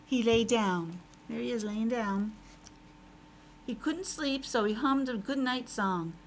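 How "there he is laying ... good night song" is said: usual speaking voice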